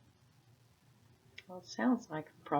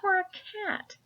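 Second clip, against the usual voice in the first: skeptical Peter; 14 semitones higher than usual. Acted. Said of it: "said Peter".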